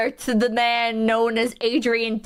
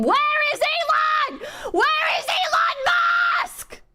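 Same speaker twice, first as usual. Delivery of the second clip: screaming. Acted. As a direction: scream the question at the crowd.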